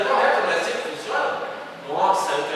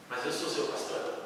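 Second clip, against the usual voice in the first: much softer, lower echo of the retort; hushed repeat; 6 semitones lower, 9 dB quieter than usual. Retold or imitated. Pastor repeating his reply to himself for the congregation, much softer. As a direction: let the retort sink to a near hush.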